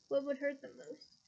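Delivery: usual speaking voice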